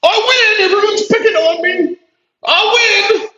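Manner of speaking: high, whiny mock victim voice; Brian Shapiro. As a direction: squeal it like a smug crybaby. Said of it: "Brian Shapiro must really be winning".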